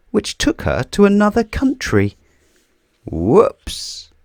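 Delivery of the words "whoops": usual speaking voice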